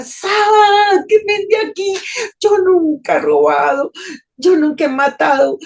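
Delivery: high, loud desperate scream to be let out; terrified screaming. Retold or imitated. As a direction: cry out in terror, sobbing. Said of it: "Con angustia y terror empecé a gritar". (grito aterrorizado y desesperado)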